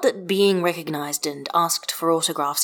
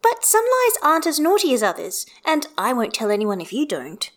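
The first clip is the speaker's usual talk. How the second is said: put-on higher, childlike and a bit secretive; a kid making a pact; conspiring little girl; 8 semitones higher, 3 dB louder than usual. A narrator's reading. Child figure Hestia.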